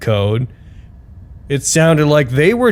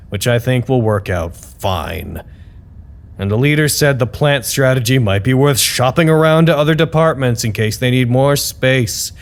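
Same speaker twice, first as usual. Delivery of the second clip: usual speaking voice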